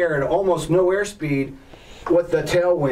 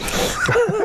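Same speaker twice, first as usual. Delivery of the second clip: laugh. Real, not acted. Laughing at own story.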